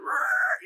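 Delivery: short, very high screech; tire screech imitation. Shown as a demonstration. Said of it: "As a tire outside just went".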